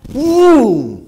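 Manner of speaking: ruido fuerte del bus pasando; estruendo imitado. Retold or imitated. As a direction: imita con fuerza el bus que pasa. El bus pasa de largo. (¡bum! fuerte y agudo)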